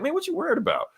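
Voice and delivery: high, soft incredulous question; a retold high squeak; high-pitched quote. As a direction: ask it up high and quiet. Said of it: "He's just like".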